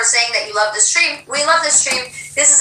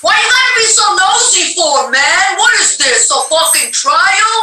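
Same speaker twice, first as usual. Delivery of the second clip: louder, higher, playfully indignant; hammed-up offended rant; mock defensive outburst; 5 semitones higher, 4 dB louder than usual. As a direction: fake outrage at being questioned.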